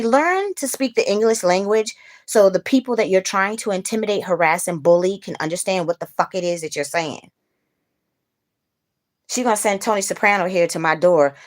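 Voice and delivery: real angry rant at her landlady's messenger; heated rant. Real, not acted. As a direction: tell them off, fast and fed up. Ranting about the intimidating man.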